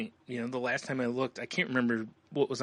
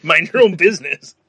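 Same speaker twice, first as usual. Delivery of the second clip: loud, higher mock scolding; mock indignant shout; 6 semitones higher, 15 dB louder than usual. Friends joking about a record purchase, a loud mock scold. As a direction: bark it back in jokey outrage.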